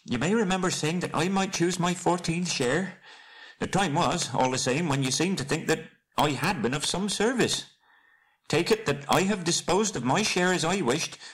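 high-pitched little hobbit voice; fussy high voice, reasoning; Bilbo, politely defensive. Acted. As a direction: argue the case politely, very high.

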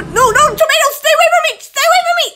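very high squealing panic through giggles; laughing panic. Pretend. Player fleeing tomato in game, giggly panic.

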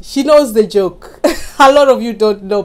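loud, amused, laughter breaking in; laughing. Real, not acted. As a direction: chuckle through the words. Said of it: "you see I'm laughing".

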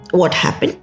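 plain reading voice

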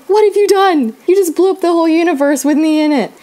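high-pitched dismayed exclamation, quick at the end; retold panicked outburst. Teller to the friend who sent the confession, panicked outburst. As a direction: blurt it out high, aghast at what was done.

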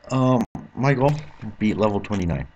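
ordinary delivery

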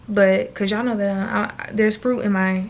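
ordinary delivery

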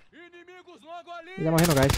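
high, slow sing-song taunt. Acted. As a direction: tease them in a high drawn-out tune. Sing-song repeat of own line.

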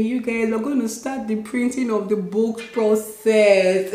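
usual speaking voice

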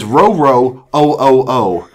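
milder read-out of dog barks; dog words repeated. Shown as a demonstration. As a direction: sound out the dog's words deliberately. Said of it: "it says".

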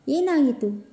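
high-pitched puzzled surprise; surprised question. A narrator's reading. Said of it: "ಆಶ್ಚರ್ಯದಿಂದ ತುಂಬಿದ್ದವು".

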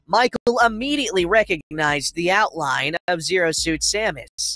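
ordinary delivery, nothing marked

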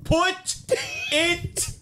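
very high, shrieked impression, word by word; imitated Jamaican scream. Showing friends how the reel woman does it, mock screaming. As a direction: screech it like the outraged woman, bursting on the last word. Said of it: "do it the way she does it".

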